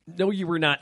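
ordinary delivery

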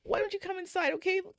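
high, sing-song coaxing, rushing the last line; lightly voiced mom soothing her child; a hint of a pleading mother. Mother coaxing her daughter inside.

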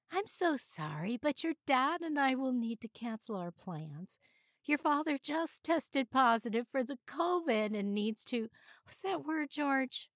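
apologize, then fumble for the word; fussy elderly mother. A narrator's reading.

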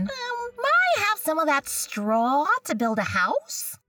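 high little pig voice. Acted. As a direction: ask politely in a high piggy voice.

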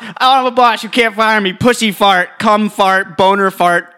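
high, loud defiant shouting. Real, not acted. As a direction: yell rude words to get fired. Shouting swear words to provoke.

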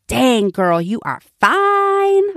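strut it, hype yourself up in the mirror; sassy self-compliment. Shown as a demonstration. Praising her reflection in the mirror, sassy and slow. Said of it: "Now, you may not talk like that".

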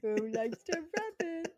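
high sing-song puppy talk; talking to a dog. Shown as a demonstration. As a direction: ask the puppy in baby talk. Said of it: "talking to Mabel like that".

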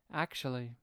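a lightly voiced smug doctor leaning back; understated smug correction from the psychiatrist. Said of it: "leans in his chair and goes".

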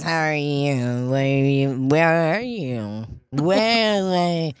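put-on slow, low, mushy drunken drawl; mock drunk, stretched out and slurred; slurred drunk imitation. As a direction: smear the words together like a drunk. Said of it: "what he ended up doing is".

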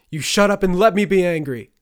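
very loud and high, raging; furious shout. Acted. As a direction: yell it with full anger. Son's furious outburst at mother.

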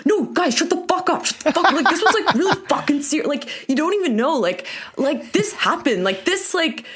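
high, pushy campfire bro; pushy bro storyteller. Retold or imitated. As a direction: insist the guys shut up and listen. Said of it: "trying to get the mood started".

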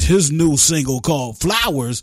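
smooth radio DJ intro; sing-song radio host announcement. Shown as a demonstration. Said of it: "I can put my D J voice on".

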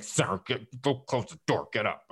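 sleep-slurred grumbling; imitating sleep talk, words running together; half-asleep mumble. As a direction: mumble it as if barely awake. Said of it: "in my sleep, I'm like".

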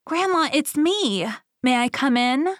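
put-on high-pitched child calling at the door; small storybook girl voice; little girl. A narrator's reading. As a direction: ask sweetly high, as Little Red.

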